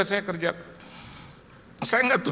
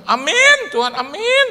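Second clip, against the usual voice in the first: high, loud joyful amen; excited amen; 13 semitones higher, 9 dB louder than usual. Retold or imitated. Preacher answers the Spirit with a high, loud joyful amen. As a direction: burst out thankful to God.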